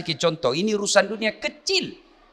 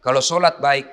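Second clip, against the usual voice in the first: ordinary delivery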